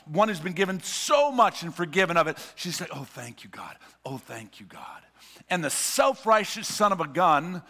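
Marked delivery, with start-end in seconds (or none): 2.9-5.0 hushed, breathless whisper of gratitude; whispered thanks. Acted. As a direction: whisper it as if kneeling at his feet. Woman thanking Jesus.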